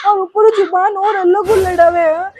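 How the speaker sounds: loud crying complaint. Acted. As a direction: sob out the accusation. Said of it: "तू रोनो बंद कर बेटा". (माँ से रोते हुए ज़ोर से शिकायत)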